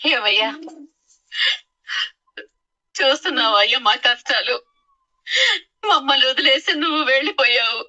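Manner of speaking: weeping lament with sobs between phrases; crying, halting prayer for help; tearful pleading. Acted. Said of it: "ఎందుకు ఏడుస్తున్నావమ్మా?".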